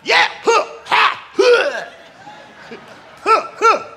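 loud high mock spirit huffs; Holy Ghost huffing. Pastor mimicking his own early prayer, loud mock huffs. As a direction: puff out hammed-up Holy Ghost grunts. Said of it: "I got all Holy Ghost on him".

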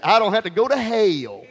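sassy, defiant declaration; with an attitude. Shown as a demonstration. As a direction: toss it off with a defiant edge. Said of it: "I always say it with an attitude".

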